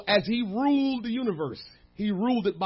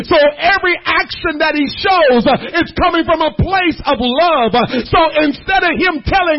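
much louder, higher shouting preacher; preaching at full force; 8 semitones higher, 14 dB louder than usual. Acted. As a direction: build the sermon to its peak. Preaching climax.

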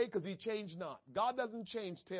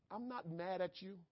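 softer, calm "I'm not mad at you"; soft reassurance; 8 dB quieter than usual. Acted. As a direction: hush it, tender as a comforting parent. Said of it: "God is saying".

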